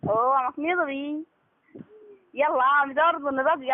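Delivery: usual speaking voice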